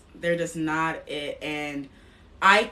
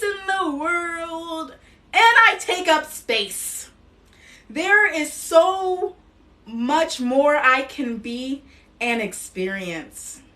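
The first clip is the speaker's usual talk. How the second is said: higher, louder, slow, proclaiming each line; emphatic affirmations; 5 semitones higher, 6 dB louder, slower than usual. Acted. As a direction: declare it for the audience to repeat. Affirmations to repeat after her.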